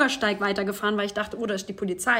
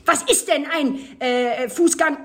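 lecturing teacher; 2 semitones higher, 5 dB louder, slower than usual. Retold or imitated. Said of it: "pädagogischen Geo-Lehrer-Vortrag".

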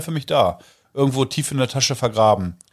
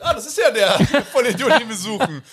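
startled recognition; 7 semitones higher than usual. Retold or imitated. Boss's startled thought voiced.

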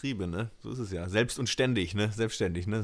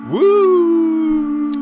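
cheer; 12 dB louder than usual. Real, not acted. Cheering at the show start.

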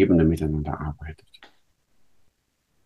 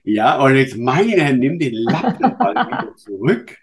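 lachend; 5 semitones higher, 5 dB louder than usual. Real, not acted. Witz über nasse Küsse.

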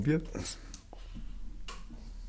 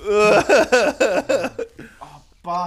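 disgusted groan; 7 semitones higher, 6 dB louder than usual. Real, not acted. Reacting to very hot candy.

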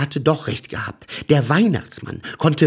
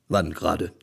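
lower, puzzled Santa voice; deep Santa voice; 7 semitones lower, 5 dB quieter than usual. A narrator's reading. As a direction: ask as a baffled Santa, lower. Weihnachtsmann fragt. (tiefe Weihnachtsmannstimme)

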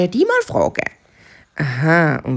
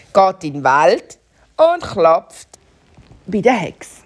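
ordinary delivery

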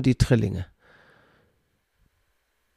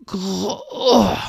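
ordinary talk

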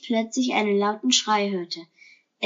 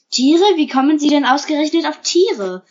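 raised, higher voice of startled protest; indignant surprise; 4 semitones higher, 6 dB louder than usual. A narrator's reading. Herr Lorenzo answers the question about animals, indignantly surprised. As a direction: throw the question back, loud and high.

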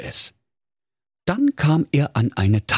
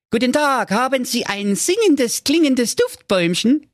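put-on high-pitched eager young man; Marco, high voice. Marco asks the grumpy dwarf for a tree, in a high voice. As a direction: pipe it up brightly, full of hope.

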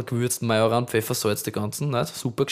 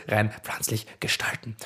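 whisper; 4 semitones lower, 8 dB quieter than usual. Acted. Sudden whisper aside.

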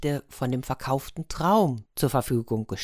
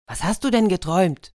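eager, a touch higher and louder; a friend eager to hear the dream; excited question; 4 semitones higher, 4 dB louder than usual. Acted. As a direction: ask it all curious and keen. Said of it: "und war ganz aufgeregt".

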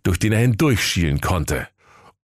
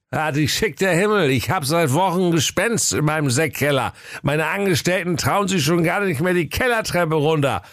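put-on higher, pleading old king; a tipsy king begging for help; 8 semitones higher than usual. King speaks, held voice.

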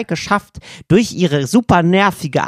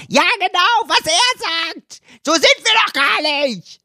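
much higher, slow indignant yelling; mock outraged agreement. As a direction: pile on the mediator, squeaky and outraged. Second party shouting agreement. (gespielt empörtes Keifen, viel höher)

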